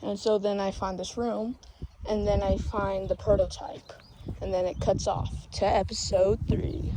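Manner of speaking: usual speaking voice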